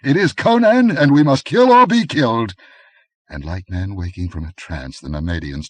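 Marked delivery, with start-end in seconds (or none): 0.0-2.5 much higher, much louder cry; rally the men in desperation; desperate rally. A narrator's reading. Aquilonian urging the others, desperate loud cry. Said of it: "muttered the Aquilonian".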